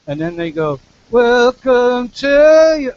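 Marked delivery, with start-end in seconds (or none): 1.1-2.9 high, slow, to as tu; closer vowel. Shown as a demonstration. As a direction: sing to rounded toward tu. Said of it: "a little closer".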